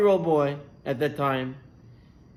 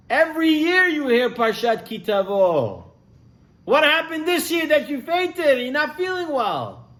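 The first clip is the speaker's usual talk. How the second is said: high, loud, baffled questions; puzzled doctors; 10 semitones higher, 4 dB louder than usual. Acted. Doctors ask the fainting boy what happened, baffled. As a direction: doctors wondering aloud, concerned.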